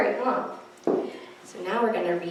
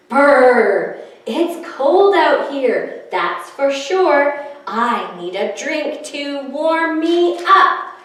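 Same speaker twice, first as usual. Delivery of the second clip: louder, shivery brr; shivering snowman; 3 semitones higher, 6 dB louder, slower than usual. Acted. Snowman Sneezy complaining to the children, shivering. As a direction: shiver through the words. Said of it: "Sneezy the Snowman shivered".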